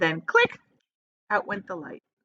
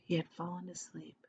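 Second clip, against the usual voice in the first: soft, breathy whisper; whispered close of the chapter; hushed narration; 3 semitones lower, 17 dB quieter than usual. A narrator's reading. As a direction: let the line fall asleep. Narrator lulls as boy sleeps.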